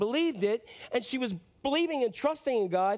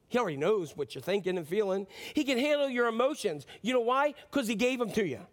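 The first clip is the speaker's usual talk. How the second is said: ordinary delivery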